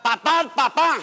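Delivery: lightly voiced child calling his father. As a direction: call out like a little boy. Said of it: "le dice el niño al padre".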